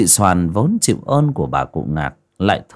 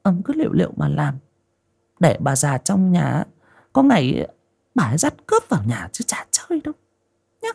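higher, quick sulky scolding that sinks to a whisper; sulky wife; 6 semitones higher than usual. Acted. Wife sulkily warns her husband about his mother, fast then whispered. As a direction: nag him fast, then hiss the threat under your breath. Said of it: "Giọng của con Hoa giận dỗi".

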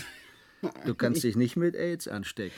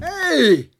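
high, loud outraged shout; angry startled shout; indignant shout; 10 dB louder than usual. Acted. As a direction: yell in protest. Simulation cut off suddenly.

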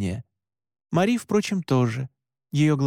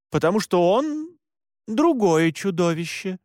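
mildly reluctant, higher-pitched explanation; 5 semitones higher than usual. A narrator's reading. Mora answering Alan's objection, mildly reluctant. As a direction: explain it with slight hesitation mid-sentence. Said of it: "нехотя пояснила Мора".

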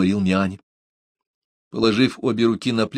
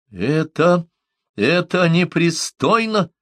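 high, halting objection; shocked protest; 9 semitones higher, 3 dB louder, slower than usual. A narrator's reading. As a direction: stammer once, then stretch the indignant word. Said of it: "чуть не онемел от неожиданности". (потрясённо, с запинкой)

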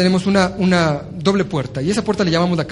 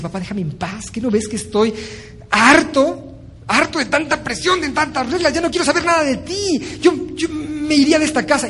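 put-on higher, fed-up teen voice; resentful teenage son; 7 semitones higher than usual. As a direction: sound like a sulky son sick of rules. Acted teen son quarrelling with father. (hijo adolescente harto, voz más aguda)

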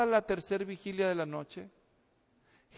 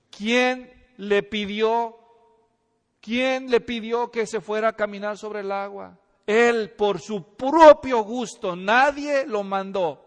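emphatic; 9 dB louder, slower than usual. Acted. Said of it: "preacher pressing the question".

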